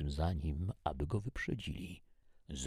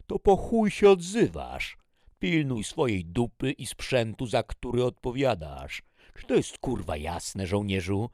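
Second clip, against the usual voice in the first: loud, raised, easing toward the end; angry dressing-down; 8 semitones higher, 11 dB louder than usual. Acted. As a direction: chew out the soldier. Major dresses down a soldier. (ostry wojskowy opieprz)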